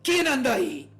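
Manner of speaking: startled, high loud shout; grito del viejo. A narrator's reading. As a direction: cry out into the dark, alarmed. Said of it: "el viejo se incorporó en su cama preguntando". (grito alarmado del viejo)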